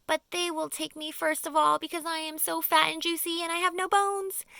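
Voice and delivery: high, loud frightened wail; a worm wailing about being eaten. Acted. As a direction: wail it out in terror. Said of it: "wailed the earthworm".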